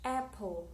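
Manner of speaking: "Apple" stressed on AP, falling away after; stress on first syllable. Shown as a demonstration. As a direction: hit the start of "Apple". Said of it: "the first syllable is the stress".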